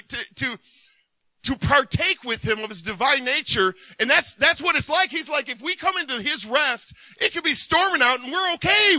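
fired-up pulpit delivery; full-force preaching. Acted. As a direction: preach it hard to the room.

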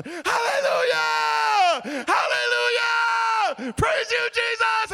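very loud, high, long-stretched hollering; full-out praise shout. Retold or imitated. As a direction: bellow praise in place of cursing. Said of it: "So I just started shouting".

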